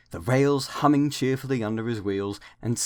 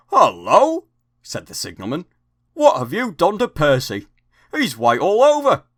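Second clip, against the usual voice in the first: higher and louder, startled greeting; surprised signalman; 6 semitones higher, 8 dB louder than usual. Acted. Signalman to Percy's crew, surprised exclaim.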